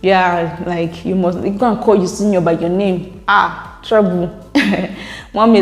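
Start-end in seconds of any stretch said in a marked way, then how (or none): none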